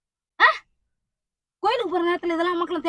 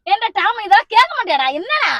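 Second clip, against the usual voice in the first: loud, high-pitched telling-off; angry scolding; 4 semitones higher, 7 dB louder than usual. Acted. Mother scolding a son who will not listen, angry. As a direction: lay into him, exasperated.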